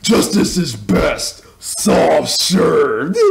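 lightly voiced cartoon character, slow; Patrick. Quoting a cartoon hero's line in a review, lightly put-on slow voice. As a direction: draw it out slowly in a mild character voice. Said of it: "that's what Patrick said".

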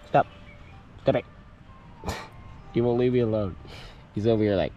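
ordinary delivery

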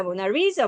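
"모나리자"의 "리"에 강세; "모나리자"에서 "리"를 힘주어. Shown as a demonstration. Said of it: "이렇게 연결해서 할 수 있겠어". ("모나리자"의 "리"를 세게)

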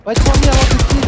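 yelling to hold back for the kill; urgent shout. Real, not acted. Gamer yells at teammates to wait for him, loud and urgent.

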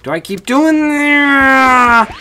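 frustrated whine. Real, not acted. Keeps hurting self in game.

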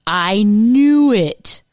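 slow, loud, drawn-out "I knew it"; loud mock triumph as Chandler; Chandler exclaiming. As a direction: stretch it out triumphantly. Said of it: "Chandler says".

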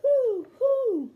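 high, loud, drawn-out owl hoots. A narrator's reading. Storyteller hooting as the big round owl to the rabbit, owl hoot. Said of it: "said a big round owl".

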